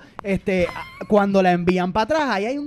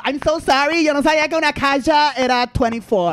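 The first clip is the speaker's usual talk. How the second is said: put-on higher, louder gringo-accented Spanglish; mock American accent; 7 semitones higher, 5 dB louder than usual. Apologizing for the case mistake, mock American accent.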